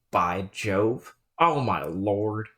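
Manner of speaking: By Jove dropped low and slow, rising at the end; a low, dry parody of the exclamation; mock By Jove. Player mocking the scholar's exclamation, low and dry.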